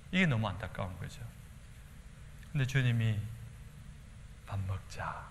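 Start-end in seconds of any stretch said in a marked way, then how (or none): 4.5-5.3 very soft, gentle call to eat; barely above a hush, inviting; soft invitation. Acted. 예수님의 조용한 부름. (조용히 부르는 예수님 목소리)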